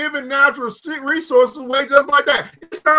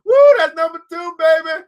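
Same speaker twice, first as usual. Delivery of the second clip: celebrating woo; 6 semitones higher than usual. Real, not acted. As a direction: gloat playfully to the viewers. Celebrating learned word.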